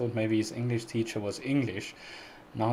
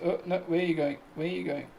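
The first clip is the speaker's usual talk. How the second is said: surprised call; 7 semitones higher, faster than usual. Real, not acted. Calling to someone wandering off.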